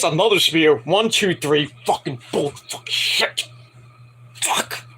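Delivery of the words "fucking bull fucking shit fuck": hushed, voiceless frustrated cursing; pissed off. Real, not acted. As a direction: swear through held-back anger. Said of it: "I am pissed".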